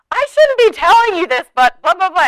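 a hammed-up juicy secret; gossipy mock. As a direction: play the eager gossip. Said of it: "we say".

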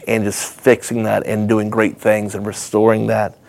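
low, soft, emotional voice; teary-eyed. Real, not acted. Vlogger moved by God changing hearts, softly teary. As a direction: speak through holding back tears. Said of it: "little teary-eyed".

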